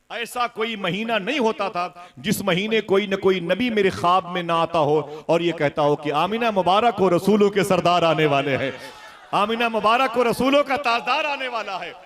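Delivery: high, loud preaching, building to a shout; rousing proclamation. A narrator's reading. Prophets congratulate Amina on the coming leader, rousing proclamation.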